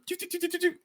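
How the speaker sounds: high, soft choo choo of failing thrusters; light sputtering engine noise; sputtering jet boots. Retold or imitated. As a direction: mimic boots running out of gas.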